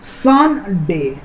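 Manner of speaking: Sunday as two drawn-out beats, सन and डे; syllable by syllable. Shown as a demonstration. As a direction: say Sunday in two parts with a pause after सन. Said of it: "दो हिस्सा में बट रहा है".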